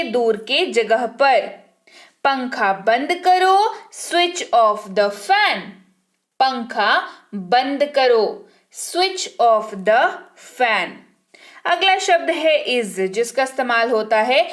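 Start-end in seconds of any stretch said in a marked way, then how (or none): 3.9-5.6 very slow, careful model sentence; very slow dictation. Shown as a demonstration. Teacher dictating to the class, very slow. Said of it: "teacher models English sentence". 8.7-11.0 very slow, careful model sentence; very slow dictation. Shown as a demonstration. Teacher dictating to the class, very slow. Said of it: "teacher repeats model sentence".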